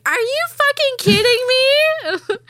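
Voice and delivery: very high, loud, slow mock wail; hammed-up tearful outburst, falling at the end; imitated crying disbelief. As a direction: draw it out like an ugly cry. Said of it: "I'm, like, ugly crying".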